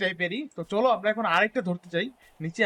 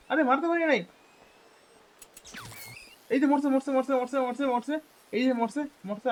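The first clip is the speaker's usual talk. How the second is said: higher, eager cheering; excited; 5 semitones higher than usual. Real, not acted. Gamer shooting a rabbit, excited. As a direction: cheer as it goes down.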